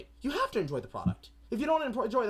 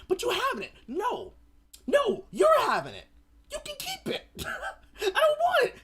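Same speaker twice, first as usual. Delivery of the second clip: high mock refusal; 4 dB louder than usual. Back-and-forth refusal.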